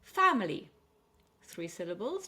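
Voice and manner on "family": "Family" with a loud, high Fam; stress on FAM. Shown as a demonstration. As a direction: punch FAM in "Family", let the rest fall. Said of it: "stress on the fam".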